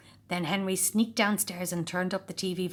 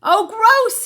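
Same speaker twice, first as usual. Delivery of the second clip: gleeful chortle; 13 dB louder than usual. A narrator's reading. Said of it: "he chortled".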